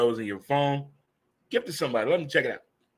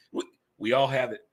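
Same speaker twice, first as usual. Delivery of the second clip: usual speaking voice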